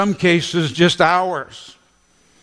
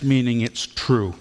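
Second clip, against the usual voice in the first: ordinary talk, nothing marked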